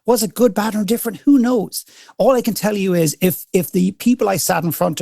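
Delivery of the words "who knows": usual speaking voice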